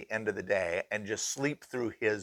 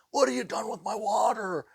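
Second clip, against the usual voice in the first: sheetrocker impression; 12 semitones higher than usual. Teller mimics the sheetrocker's way of talking: high frantic shout. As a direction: do the worker's frantic yell. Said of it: "he talks just like this".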